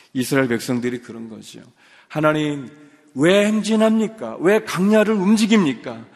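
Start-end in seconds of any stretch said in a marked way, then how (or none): 2.1-6.1 higher, louder indignant outcry; grumbling people shouting at heaven; complaining Israelites. Retold or imitated. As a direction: cry out at God in protest. Voicing Israelites complaining to God.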